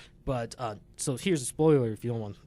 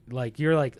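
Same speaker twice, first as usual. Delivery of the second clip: ordinary talk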